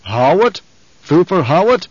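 rising, startled questions; surprised. Acted. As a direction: react with sharp surprise. Baron surprised.

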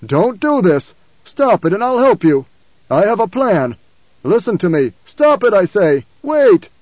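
put-on raving, loud, desperate old tyrant; Baron Harkonnen. A narrator's reading. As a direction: bargain and command at a shout, rising to panic. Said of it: "but the voice still raved".

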